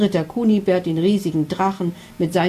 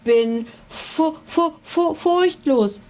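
slow, much higher frightened stutter on fu-; stuttering in fear; 5 semitones higher, much slower than usual. A narrator's reading. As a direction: stammer fu- fu- fu- before forcing out furchtlos. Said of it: "stotterte er".

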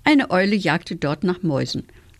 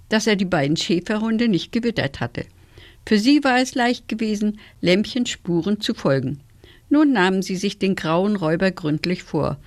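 usual speaking voice

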